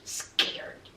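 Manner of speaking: breathy taunting whisper; mocking whisper. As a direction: hiss it teasingly under the breath. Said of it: "Rosemary was like".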